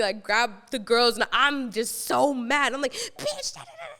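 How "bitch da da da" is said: very high, squeaky scream held way down in volume; mock angry screaming. Storyteller reenacting her rage at the girls, hushed mock scream. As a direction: imitate furious screeching without the volume. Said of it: "just screaming 'cause I'm so angry".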